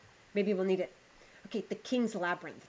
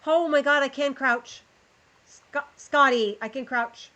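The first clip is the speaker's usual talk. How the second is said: loud, higher, thrilled shout; excited outburst; 6 semitones higher, 8 dB louder, slower than usual. Real, not acted. Streamer thrilled to find she can crouch, loud excited outburst. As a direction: blurt it out, delighted by the discovery.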